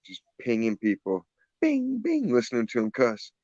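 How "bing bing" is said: high little "bing" noises; ping like shots in a high voice; imitated sniper pings. Gamer mimicking sniper shots pinging people, high-pitched.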